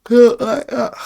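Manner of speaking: yawn-stretched "I don't like that"; yawning through it. Real, not acted. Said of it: "Why am I yawning so much?".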